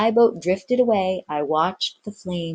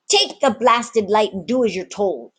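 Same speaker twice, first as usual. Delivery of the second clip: louder, rough pirate command; gruff order; 5 semitones higher, 3 dB louder than usual. Acted. Ginger orders the boy off, gruff and rough. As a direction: cut the argument short.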